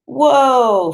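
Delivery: loud, high burst of wonder; excited whoa. Acted. Boy after escaping danger, an excited whoa. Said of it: "Freak says".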